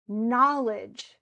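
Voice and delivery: "Knowledge" as KNOWL-edge, first syllable punched; "Knowledge" set apart, strong beat then weak; stress on KNOW. Shown as a demonstration. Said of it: "same thing".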